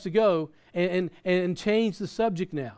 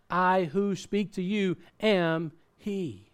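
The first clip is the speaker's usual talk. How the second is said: slow solemn reveal; much slower than usual. Acted. Reading Jesus' reveal slowly.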